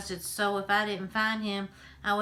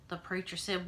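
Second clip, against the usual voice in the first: ordinary delivery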